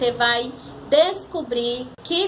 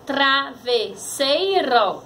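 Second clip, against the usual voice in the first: "Travesseiro" slowly in four syllables; sílabas separadas; much slower than usual. Shown as a demonstration. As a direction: break "Travesseiro" into tra, ves, sei, ro. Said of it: "vamos separar a palavra". ("Travesseiro" devagar, sílaba por sílaba)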